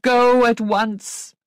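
high, loud, drawn-out order; urgent command. A narrator's reading. Governess sending Missus Grose away, urgent command. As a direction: send them off with a slow loud command.

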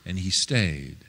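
ordinary delivery

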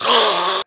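loud, sharp acted gasp; huge startled intake of breath; sudden gasp. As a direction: gasp hard, as if attacking. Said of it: "then suddenly she goes".

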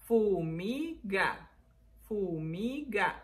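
"Fumiga" slowly in three held syllables. Shown as a demonstration. Teacher showing three syllables, "Fumiga" drawn out. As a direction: stretch "Fumiga" as fuu, mii, gaa. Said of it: "Son tres sílabas ahora".

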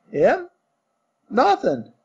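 lightly voiced Cockney servant; Cockney bellboy. Thomas the bellboy answering his master, light Cockney. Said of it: "Cockney dialect".

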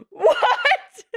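high, slow, laughing through the words; laughing disbelief. Real, not acted. Disbelief at the scene.

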